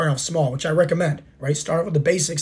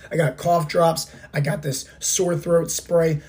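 ordinary delivery

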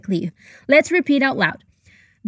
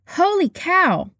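surprised; 5 semitones higher, slower than usual. Shown as a demonstration. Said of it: "It's used to express surprise".

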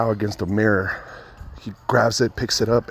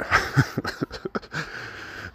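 quiet unvoiced laugh; breathy laugh; 7 semitones higher, 6 dB quieter than usual. Real, not acted. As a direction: laugh softly under breath. Laughing at own story.

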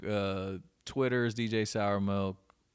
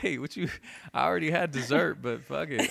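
mock eager voice; 6 semitones higher, 4 dB louder than usual. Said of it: "I was like".

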